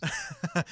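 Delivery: very high, soft squeaky laugh; high squeak laugh. Real, not acted. Host laughing at co-host's grudge, squeaky laugh.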